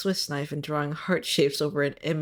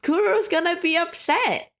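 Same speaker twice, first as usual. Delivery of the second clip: much higher, louder playful alarm; exaggerated pretend distress at a friend; mock panic; 11 semitones higher, 7 dB louder than usual. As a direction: ham up the dramatic panic, half laughing.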